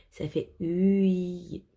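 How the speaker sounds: "ui" stretched very slowly; drawn-out sound. Shown as a demonstration. Teacher sounding out a vowel for the children, very slowly. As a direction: draw out "ui" slowly so the children hear it. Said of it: "teacher stretches a sound".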